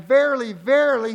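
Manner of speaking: loud, high "Verily, verily"; preacher's ringing "verily"; emphatic shout. Shown as a demonstration. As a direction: proclaim "Verily" with force. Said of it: "he was emphasizing".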